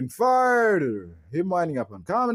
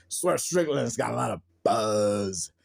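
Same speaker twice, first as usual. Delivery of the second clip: lightly mimicked wrestler promo; imitating Moxley; 5 semitones lower than usual. Mimicking Moxley forgetting his promo, lightly voiced. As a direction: say it like a stalled promo.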